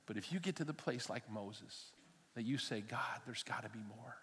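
soft, hushed prayer voice; prayerful plea. Acted. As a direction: pray it quietly to God. Prayerful close.

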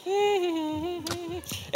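soft, high-pitched hum; carefree wordless humming; humming to himself. Acted. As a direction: hum a long quiet note to yourself. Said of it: "he was humming to himself".

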